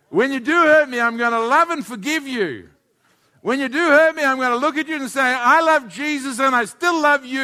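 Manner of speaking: high, loud preacher's declaration; impassioned pulpit delivery; forceful preaching. Acted.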